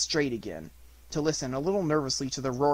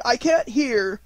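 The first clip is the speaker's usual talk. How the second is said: high, raised call over wind; shouting; 8 semitones higher, 5 dB louder, slower than usual. A narrator's reading. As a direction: call out against a gale. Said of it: "holding on to each other and shouting".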